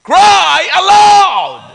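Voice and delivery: high, very slow full shout; crying aloud. Shown as a demonstration. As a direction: stretch each word into a preacher's cry. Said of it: "Nagtataka kayo kung bakit sumisigaw?".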